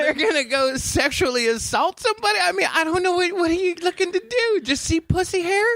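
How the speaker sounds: very high-pitched exasperated questioning; incredulous rant. Acted. Movie critic questioning the panty raiders, high incredulity. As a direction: pile up questions in high, squeaky disbelief.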